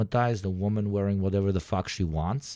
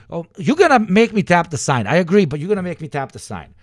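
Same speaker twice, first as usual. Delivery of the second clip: higher, louder exasperated declaration; louder, tap-the-sign exasperation; 10 semitones higher, 10 dB louder than usual. Real, not acted. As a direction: raise your voice, fed up. Exasperated rant start.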